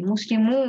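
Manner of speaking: মুসলিমু with a long, pushed "muu"; madd after u. Shown as a demonstration. Teacher demonstrates madd after u, lengthened vowel. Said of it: "একটা মাদ হচ্ছে".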